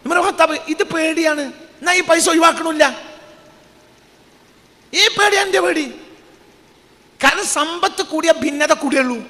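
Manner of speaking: high, fervent preaching; preaching at full force. Real, not acted. Preacher warning the crowd about wealth, fervent and high. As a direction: press the warning home with fire. Preacher driving home his point.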